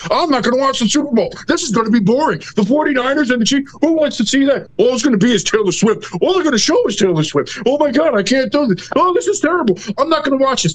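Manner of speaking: sarcastic mimicry of a moaning viewer, pitch up; mock whining fan. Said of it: "Nobody wants to hear".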